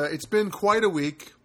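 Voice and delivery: usual speaking voice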